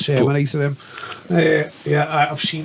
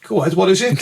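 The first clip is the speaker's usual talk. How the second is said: laughing; 4 semitones higher, faster than usual. Retold or imitated. Eager reply to drug offer.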